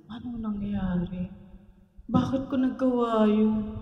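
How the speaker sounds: hushed, bewildered self-question; half to yourself, stunned after the rage; dazed whisper-like. Retold or imitated. Imitating an angry man waking up dazed, softly. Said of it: "ano unang-unang sinasabi?".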